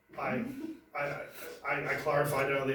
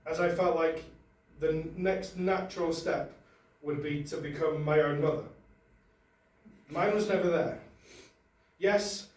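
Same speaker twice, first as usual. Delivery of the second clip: mock-solemn prepared statement, higher and louder; formal statement; 3 semitones higher, 5 dB louder than usual. Comic reading a prepared statement to the audience, mock-formal. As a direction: announce it like a press release.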